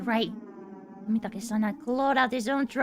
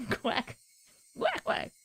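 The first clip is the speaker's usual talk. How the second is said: normal talking voice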